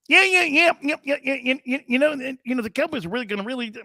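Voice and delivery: high, squeaky coach impression, babbling. Imitating Coach Landry at the mic, high squeaky voice. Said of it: "got a squeaky voice like that".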